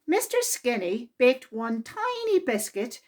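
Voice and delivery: usual speaking voice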